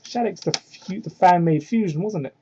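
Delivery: ordinary delivery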